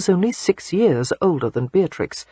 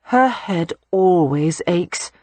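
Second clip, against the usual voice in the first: dry exasperation; 2 semitones higher, much slower than usual. A narrator's reading. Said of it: "Audrey glanced heavenward".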